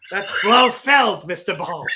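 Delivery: mock villain. Drunk friend playing villain.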